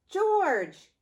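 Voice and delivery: calling out. Acted. Friend calls George down from the street, a loud call. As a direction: call his name up to him.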